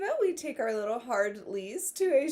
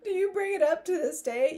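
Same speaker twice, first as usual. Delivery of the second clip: laughing; 8 semitones higher, 7 dB louder than usual. Real, not acted. Still laughing.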